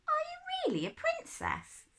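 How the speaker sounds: put-on high, wondering child; little girl voice. A narrator's reading. Ellen asking the stranger if she is a princess. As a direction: ask it wide-eyed and high.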